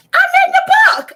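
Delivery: high, loud, fast burst of delight; excited squeal. Real, not acted. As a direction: blurt it out thrilled. Excited reveal of being featured.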